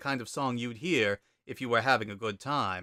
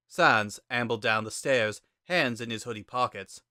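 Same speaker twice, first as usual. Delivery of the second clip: plain reading voice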